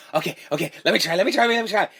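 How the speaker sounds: eager. Retold or imitated. Acting an eager boxing pupil.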